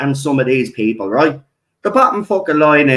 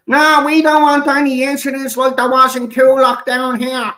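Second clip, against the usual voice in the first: mock locals; 10 semitones higher than usual. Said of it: "locals refusing help, mock voice".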